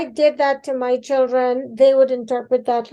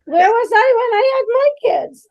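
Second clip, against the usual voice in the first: laughing; 9 semitones higher, 5 dB louder, faster than usual. Real, not acted. Laughing at cohost's question.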